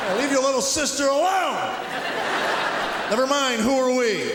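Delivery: high, laughing mock yell at kids. Comic tourist yelling at strangers' kids on the beach, mock yell. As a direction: bark at other people's kids, half joking.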